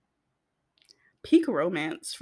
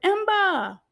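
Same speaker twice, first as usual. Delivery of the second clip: exasperated; 11 semitones higher, 4 dB louder than usual. Retold or imitated. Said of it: "And I was like".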